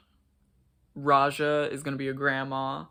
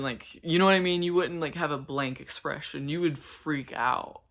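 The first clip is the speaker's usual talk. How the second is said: ordinary talk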